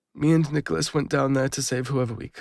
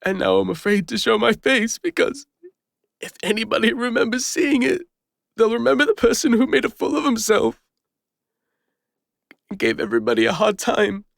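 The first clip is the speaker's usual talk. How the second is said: anxious outburst; 6 semitones higher, 4 dB louder than usual. Acted. Confessing shame and fear of recognition.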